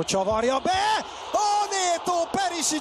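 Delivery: high excited shout; excited chance call. Real, not acted. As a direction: shout as the ball curls in. Chance called live.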